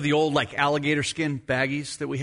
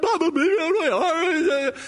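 put-on higher, slow, pitiful boy's wail; mock whimpering child; 10 semitones higher than usual. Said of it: "I'm like".

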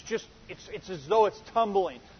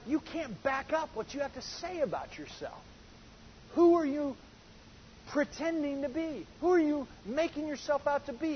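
incredulous challenge; 8 semitones higher, 5 dB quieter than usual. Retold or imitated. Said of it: "They're like".

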